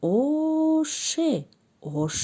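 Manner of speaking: high drawn-out "Оше" then "ош"; slow phonics blending; sounding out. Shown as a demonstration. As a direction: stretch the vowel then blend. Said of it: "Бале, мешавад".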